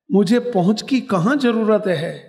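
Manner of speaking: emphatic imitation of an elderly woman; old woman's reply. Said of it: "પેલા માજી શું બોલ્યા સાંભળજો".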